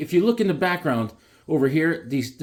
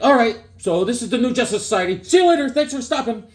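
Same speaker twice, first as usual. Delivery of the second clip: higher, louder, rushed mock sign-off; mock hasty editor; 7 semitones higher, 4 dB louder, faster than usual. Comic writers rushing the ending, a mock hasty sign-off. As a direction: wrap it up fast and breezy. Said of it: "then at the end they're like".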